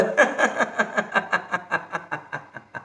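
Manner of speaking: long rhythmic laugh, fading away; released laughter. Shown as a demonstration. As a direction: let the breath out as a long staccato laugh. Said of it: "द्या फेकून सगळं ओझं". (श्वास सोडत लांब हास्य)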